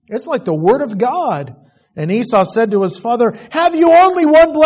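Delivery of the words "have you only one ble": desperate plea. Acted. Esau's desperate plea, cut off.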